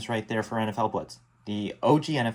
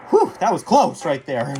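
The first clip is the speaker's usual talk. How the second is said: relieved sigh; 3 semitones higher, 5 dB louder than usual. Real, not acted. Close call in the game.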